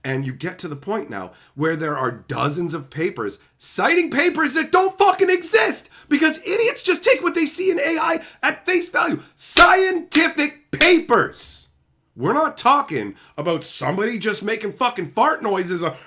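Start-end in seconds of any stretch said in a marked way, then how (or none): 3.6-11.7 much higher and louder, slowing to a shout on the last words; yelling in disbelief; a furious, incredulous yell; outraged shout. Real, not acted. Outraged shout at fake citations.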